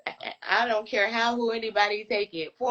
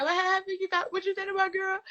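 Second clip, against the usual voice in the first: imitating the boyfriend; 11 semitones higher than usual. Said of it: "he was like".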